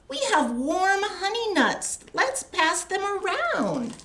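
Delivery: high cheerful critter voices; small animals offering a treat; Gopher and Mole voices. Acted. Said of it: "Gopher and Mole tunnel up".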